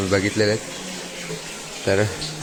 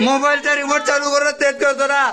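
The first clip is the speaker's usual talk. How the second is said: exasperated; 11 semitones higher than usual. Real, not acted. Brother explains in exasperation.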